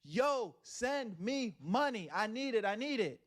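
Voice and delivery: high, drawn-out mock begging; mock money demand. Imitating a streamer begging viewers for money, mock pleading. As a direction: stretch it out as an exaggerated plea.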